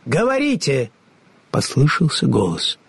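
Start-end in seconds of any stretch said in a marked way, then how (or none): 0.1-0.9 high, lofty and commanding; grand wizard voice. A narrator's reading. The unseen wizard commands the travellers, high and lofty. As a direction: speak as an all-powerful unseen ruler.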